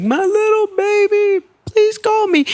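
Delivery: high, mock-weepy mom pleading; mock crying mom. Mom at the airport begging her son to call, mock-sobbing high voice. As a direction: ham up a tearful mother's goodbye. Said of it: "My mom is in boo-hoo tears".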